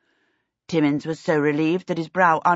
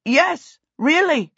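high, raised-voice confirmation; shouting through noise; 10 semitones higher, 5 dB louder, slower than usual. A narrator's reading. Peter answers the clamouring kobolds, shouting over them. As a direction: call it out over a hubbub. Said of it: "Peter shouted through the noise".

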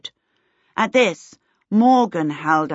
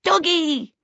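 put-on high-pitched toddler exclamation; toddler cry; 11 semitones higher than usual. A narrator's reading. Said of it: "gave a loud cry of".

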